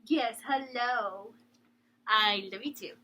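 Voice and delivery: slow, tender pet voice; drawn-out, loving coo. Real, not acted. Talking to her dog.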